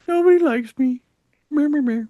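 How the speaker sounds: high, whiny mope; mock self-pitying whimper; mopey whine. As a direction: sulk it out like a sad ghost. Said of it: "he's still feeling like".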